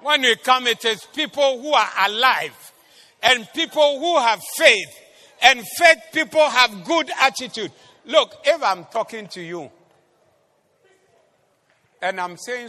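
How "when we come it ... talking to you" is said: loud, raised, emphatic sermon voice; forceful preaching. Real, not acted. Preacher telling church about people of faith, forceful preaching. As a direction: drive each phrase home to the congregation.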